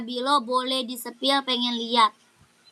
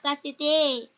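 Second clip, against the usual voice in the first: ordinary talk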